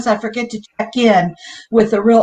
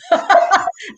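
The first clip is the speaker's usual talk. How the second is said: high laugh; 9 semitones higher than usual. Real, not acted. Laughing at herself.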